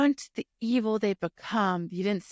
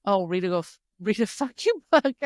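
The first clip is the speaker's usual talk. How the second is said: laughing; 4 dB louder than usual. Real, not acted. Laughing echo.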